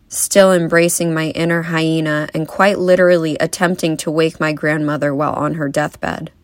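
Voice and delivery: normal talking voice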